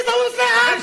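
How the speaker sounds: shouted command. Acted. Soldier ordering to aim.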